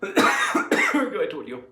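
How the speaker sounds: ordinary talk, nothing marked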